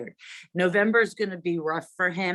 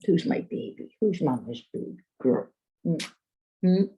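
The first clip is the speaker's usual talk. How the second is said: talking to a dog. Real, not acted. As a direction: murmur sweet nothings to the pet. Cuddling and praising the dog.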